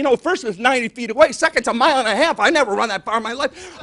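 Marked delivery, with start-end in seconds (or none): none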